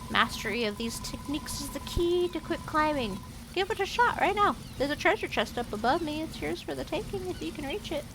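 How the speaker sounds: a cheerful little mouse showing off its climbing tricks; chirpy mouse guide. Acted. Voices a mouse guide's dialogue.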